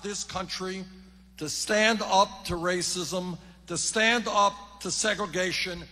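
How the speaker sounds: loud, fired-up stump speech; rally speech. Acted. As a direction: rouse the crowd from the podium.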